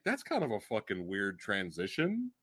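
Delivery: a quiet, dry aside to yourself; deadpan aside. Retold or imitated. Remarking to themself in the theater, quiet and low. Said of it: "I was like".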